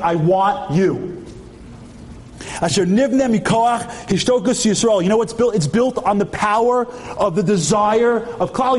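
high, urgent sermon delivery, key words stretched out; impassioned preaching. Real, not acted. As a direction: preach it with rising fervour.

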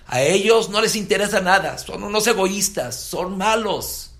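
high, loud bitter tirade, slowing on son malos; angry rant. Retold or imitated. Young Akiva railing against the religious, loud and bitter. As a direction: spit out contempt for the religious.

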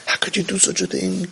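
hushed, contemptuous scolding; mock disgusted hush; disgusted rebuke. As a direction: recoil from him in quiet disgust. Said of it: "He didn't say".

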